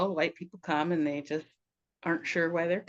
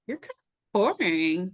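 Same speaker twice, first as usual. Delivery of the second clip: quoting friend; 6 dB louder, slower than usual. Retold or imitated. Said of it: "And she was like".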